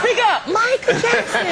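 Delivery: high, loud, slow laughing yell; mock shout. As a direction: bellow it up high through laughter. Mock yelling at soft-spoken Michael.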